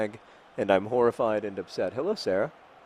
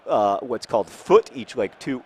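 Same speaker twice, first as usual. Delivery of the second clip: ordinary talk, nothing marked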